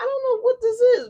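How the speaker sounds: high and loud, thrilled; excited outburst. Real, not acted. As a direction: burst out, can't contain it. Excited exclamation over the reading.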